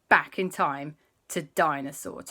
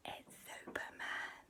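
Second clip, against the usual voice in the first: very soft, hushed reveal; secretive whisper; 19 dB quieter than usual. Acted. Auntie introduces the disguised new friend, whispering.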